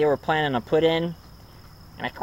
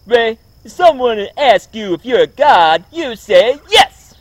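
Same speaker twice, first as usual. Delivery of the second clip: much higher and louder, slow brash yell; Eddie Murphy impression; 8 semitones higher, 9 dB louder than usual. Vlogger voicing Eddie Murphy telling Ray, loud slow brash impression. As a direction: belt it out, stretching the last words. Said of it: "that's what it sound like if Eddie Murphy played".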